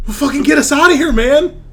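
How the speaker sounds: high, loud, rushed yell; frantic high-pitched shouting; panicked Hobbs. Acted. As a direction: shout to get out, fast and frantic. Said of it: "Hobbs is like".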